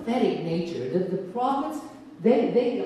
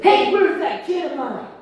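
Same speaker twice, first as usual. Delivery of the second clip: loud and higher, barging in cheerfully; booming grandpa; 7 semitones higher, 8 dB louder than usual. Acted. Grandpa walking in on the sleeping baby, loud and jolly. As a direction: call it out like you just walked through the door.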